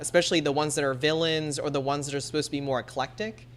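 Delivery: ordinary delivery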